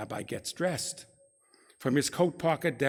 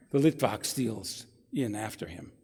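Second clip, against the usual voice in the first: normal talking voice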